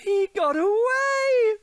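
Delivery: very high slow cry of triumph; ecstatic cheer. Acted. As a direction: whoop with pure joy. Cockroach survives, elation.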